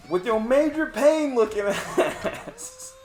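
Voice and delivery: high, drawn-out teasing jab; playful mock insult. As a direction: rib your friend with a hammed-up squeal of an insult.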